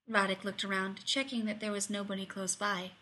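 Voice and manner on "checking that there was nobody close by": usual speaking voice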